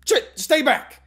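startled yell, high and loud. Acted. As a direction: shout it in sudden fright. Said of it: "I yelled".

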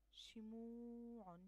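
شموع very slow with the long و held out; slow demo of شموع; drawn-out model word. Shown as a demonstration. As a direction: model شموع slowly for children. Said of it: "شموع عندي هنا الحرف الممدود".

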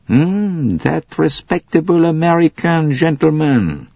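high, knowing echo, falling to a statement; emphatic Poirot. A narrator's reading. Poirot echoes Bouc's words back, high and knowing. Poirot confirms his suspicion, echoing Bouc.

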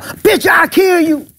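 high, slow shouted threat; mock threat. Teddy Carpenter threatening Dirty South, with a high slow shouted threat. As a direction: spit out the threat slowly.

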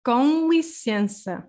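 "com licença" with a nasal com and a nasal en, spoken slowly; Portuguese model phrase. Shown as a demonstration. Teacher modelling the phrase for learners, slow and nasal. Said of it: "I'm gonna say this, these words again".

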